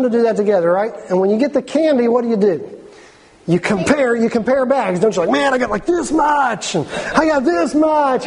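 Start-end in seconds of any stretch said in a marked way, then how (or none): 5.3-8.3 put-on excited kid. Kids comparing candy bags, excited.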